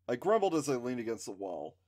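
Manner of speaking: ordinary delivery, nothing marked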